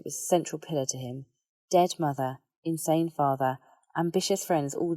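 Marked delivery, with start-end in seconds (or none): none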